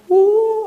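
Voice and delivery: sudden high, loud "oh" yell; mock shout. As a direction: imitate someone who habitually yells. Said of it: "하고 소리 지르는 사람인지도 몰라요". (소리 지르는 사람 흉내)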